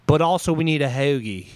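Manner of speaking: exaggerated Philly vowels on hoagie; mock an overdone Philly accent; Kate Winslet. Comic parodying Kate Winslet's line endings, hammed-up Philly accent. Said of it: "the end of the sentence would always be".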